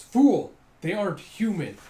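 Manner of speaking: loud, high barked scolding; angry rebuke. Retold or imitated. Headmaster Radon scolding Toji, angry shout. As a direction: snap at him like a furious master.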